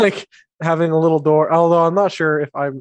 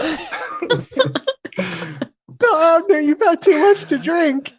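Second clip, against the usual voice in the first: much higher scolding voice; mimicked voice; 10 semitones higher than usual. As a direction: scold high like a fussing friend. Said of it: "mimic the voice".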